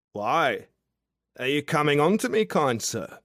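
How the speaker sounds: a tipsy outback charmer calling up to a window; drunk Aussie guy. Acted. Drunk Australian guy below her window, boisterous and cheeky.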